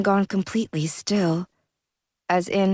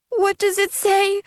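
sobbing; 13 semitones higher than usual. Acted. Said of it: "Jack hiccuped between sobs".

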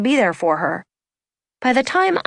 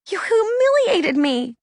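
high, slow, wounded outburst; hurt accusation; 12 semitones higher, slower than usual. A narrator's reading. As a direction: accuse her, drawing the words out high. Anna accusing Abby in a quarrel.

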